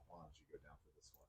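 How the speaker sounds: soft, fast whisper; whispered to wife. Retold or imitated. Dad whispering to his wife to go comfort the boy, softly and fast. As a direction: hush it so only she hears. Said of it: "I'm like".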